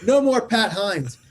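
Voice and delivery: loud, high fed-up announcement; acted yell, laying down the law. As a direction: shout it to the whole ward. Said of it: "And I yell out".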